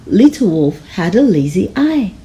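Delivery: ordinary delivery, nothing marked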